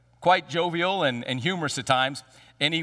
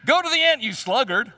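emphatic call; 8 semitones higher, 4 dB louder than usual. Shown as a demonstration. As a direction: Go to the ant shouted high and loud.

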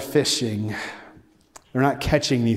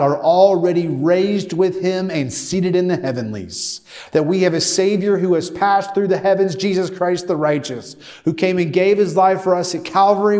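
high, loud rising sermon climax; full-force preaching; 6 semitones higher, 5 dB louder than usual. Acted. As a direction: preach it out to the whole crowd.

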